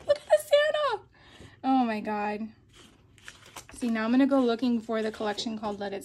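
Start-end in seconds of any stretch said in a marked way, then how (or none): 0.1-1.0 very high delighted squeal; excited squeal. Real, not acted. Gushing over Santa sticker.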